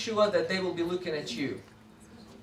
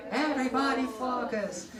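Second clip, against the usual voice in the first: put-on kids' response; 6 semitones higher, 3 dB quieter than usual. Shown as a demonstration. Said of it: "and they say".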